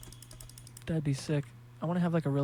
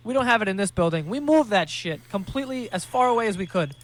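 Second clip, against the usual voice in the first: louder and higher, mocking the worker; loud, pitched-up imitation of the guy at the cargo desk; mock loud airport worker; 4 semitones higher, 7 dB louder than usual. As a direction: ham up the airport guy's blustering reply. Voicing the airport guy's reply.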